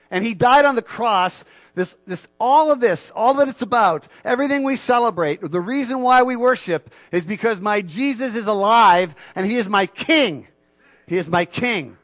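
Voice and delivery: loud, high, fervent preaching; triumphant loud praise of Jesus as king; preaching at full force. Acted. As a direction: lift the congregation up, full voice.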